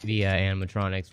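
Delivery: normal talking voice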